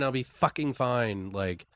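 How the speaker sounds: ordinary talk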